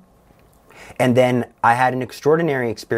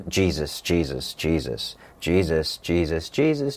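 repeated prayer; 5 semitones lower, 4 dB quieter than usual. Retold or imitated. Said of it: "just repeating the name without it making much sense".

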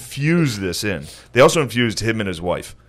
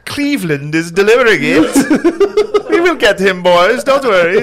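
put-on higher, slow, laughing German-accented Nazi; mock German accent, laughing through it; 8 semitones higher, 4 dB louder, slower than usual. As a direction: ham up a German officer, higher. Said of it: "You're good at German".

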